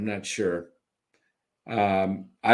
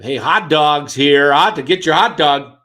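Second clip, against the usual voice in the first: loud ballpark vendor call; stadium vendor hawking; 2 semitones higher, 8 dB louder than usual. Retold or imitated. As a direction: bellow it like a hot dog vendor.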